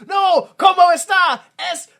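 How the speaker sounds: high, loud, slow mock shout; mock shouted Spanish. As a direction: yell it in fake Spanish. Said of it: "he's like".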